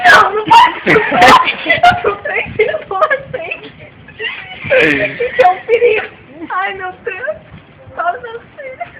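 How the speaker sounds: mother sobbing to the policeman about the stolen money. Acted. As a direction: wail it out like a distraught mom begging for help. Mother crying, fearing drugs.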